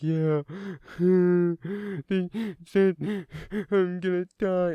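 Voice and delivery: put-on high, loud, sobbing small-kid voice; acted kid crying fit, words half understood; crying little kid. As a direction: blubber it out like a panicked little boy. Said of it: "best reenactment I could do of a little kid fucking crying".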